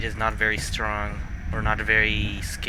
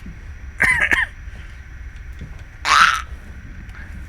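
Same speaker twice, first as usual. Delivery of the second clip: Donald Duck voice. Acted. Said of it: "I'm not very good at Donald Duck either".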